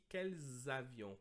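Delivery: "quels avions" slowly linked, kelz avion; slow liaison. Shown as a demonstration. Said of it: "A mesma coisa aqui".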